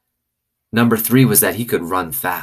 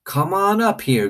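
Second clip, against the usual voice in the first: hard and serious; 12 semitones higher than usual. Acted. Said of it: "her voice all hard and serious".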